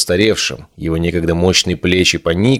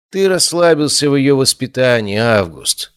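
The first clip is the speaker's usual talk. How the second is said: grumbling; 7 semitones higher than usual. A narrator's reading. Nairon reproaches August over her upbringing, grumbling. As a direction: grumble it as a complaint. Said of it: "буркнул Найрон".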